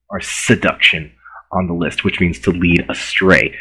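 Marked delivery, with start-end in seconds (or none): none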